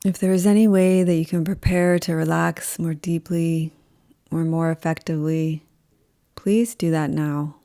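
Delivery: slow, calm yoga nidra voice with long pauses; guided meditation. Acted. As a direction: guide them unhurriedly into rest, leaving space after each phrase.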